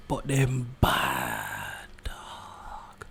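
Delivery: breathy hushed whisper. Real, not acted. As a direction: drop to a whisper. Whispered aside about photos.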